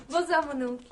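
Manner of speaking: goodnight said through a laugh; laughing greeting. Acted. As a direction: laugh as you greet him. Niece greets uncle, laughing. (la nièce salue son oncle en riant)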